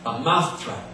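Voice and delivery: lisped "mousetrap" with th for s; "mousetrap" said maʊθtrap; a lisping "mousetrap". Shown as a demonstration. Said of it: "if you have a lisp".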